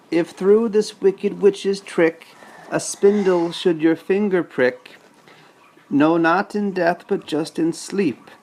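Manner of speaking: dad voicing the good fairy's rhyme, lilting; fairy's spell rhyme. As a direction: chant it like a gentle magic spell.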